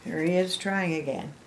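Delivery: usual speaking voice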